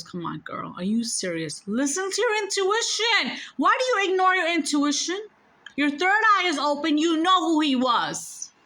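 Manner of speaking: high-pitched exasperated telling-off, rising in volume; Jesus playfully exasperated with her; exasperated chiding. Retold or imitated. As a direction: scold a friend who should know better. Jesus scolds her in retold exchange.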